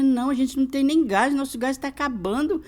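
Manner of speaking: high-pitched retold plea, played up; imitating your own melodramatic appeal for sympathy; dramatic pleading. Mother re-enacting her gas plea to her husband, dramatic. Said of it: "eu fiz aquele drama".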